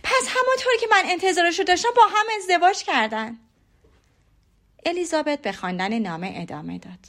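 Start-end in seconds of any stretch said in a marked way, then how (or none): 0.0-3.4 high, fast, loud outburst; exclaiming aloud. A narrator's reading. Jane interrupting the letter about the marriage, exclaiming loudly. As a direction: burst out loudly, voice pitched right up. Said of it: "جین با صدای بلند گفت".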